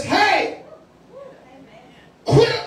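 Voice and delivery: Jesus telling the grieving widow, a light commanding call. Acted. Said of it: "Jesus steps up and says".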